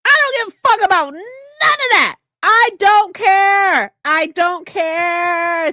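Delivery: high, slow, shouted refusal; defiant shout. Pretend. Streamer shouting defiantly to chat. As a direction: yell it like you truly don't care.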